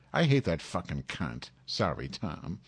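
ordinary talk, nothing marked

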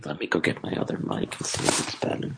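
fast, soft, hushed whisper. Real, not acted. Caller off to swap microphones, whispering. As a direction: talk low and quick, almost all breath. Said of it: "sound like you're whispering to an aide".

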